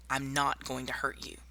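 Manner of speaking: ordinary delivery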